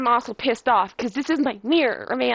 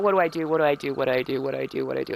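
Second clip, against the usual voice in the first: panicked repetition; 10 semitones lower, 3 dB quieter, much faster than usual. Retold or imitated. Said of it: "I'm trying to figure out".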